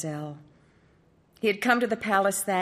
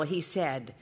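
ordinary delivery

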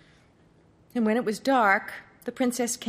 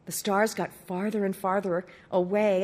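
usual speaking voice

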